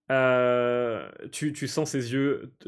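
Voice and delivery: normal talking voice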